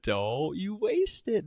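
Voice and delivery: slow high mock threat; high slow mock menace. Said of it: "He said, he said".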